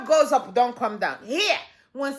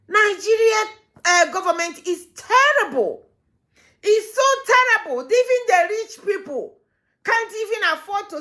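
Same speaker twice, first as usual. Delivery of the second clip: outraged outburst; 8 semitones higher than usual. Real, not acted. Outraged commentator railing at government.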